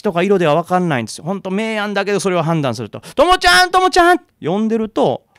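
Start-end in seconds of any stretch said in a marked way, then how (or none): 3.1-4.2 high, loud desperate calls into the dark; frantic calling. Retold or imitated. Mother searching the riverbank calls for her daughter, frantic high shouts.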